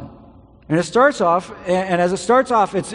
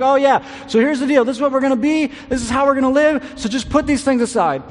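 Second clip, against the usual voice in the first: breezy and offhand, pitched higher, moving quickly through it; flippant; 7 semitones higher, 4 dB louder than usual. Retold or imitated. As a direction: toss it off as if it's no big deal. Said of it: "it's almost flippant".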